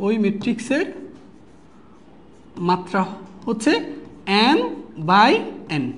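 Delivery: long gaps between short phrases; slow dictation. Real, not acted. Teacher giving students the matrix order note, slow dictation. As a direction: dictate piece by piece, leaving time to write.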